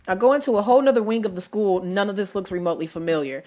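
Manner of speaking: normal talking voice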